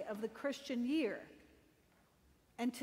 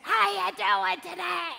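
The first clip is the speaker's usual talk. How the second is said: raspy, higher, louder greeting; a raspy woman rushing out to greet passersby; raspy exuberant neighbor; 6 semitones higher, 7 dB louder than usual. Retold or imitated. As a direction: call out like a cheerful raspy neighbor. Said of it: "a very raspy voice".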